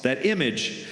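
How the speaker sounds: ordinary talk, nothing marked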